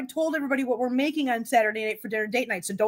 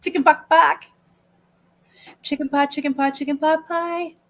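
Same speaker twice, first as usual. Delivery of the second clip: quoting a reference; 4 semitones higher, 6 dB louder than usual. Retold or imitated. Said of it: "if anybody in here knows that reference".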